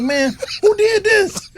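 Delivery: high, slow, drawn-out wonder; kid's amazement. Retold or imitated. Retelling his childhood awe at the neighbor's house, high and slow. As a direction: marvel at the big house as a child. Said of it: "I'm like".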